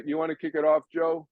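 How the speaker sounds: ordinary delivery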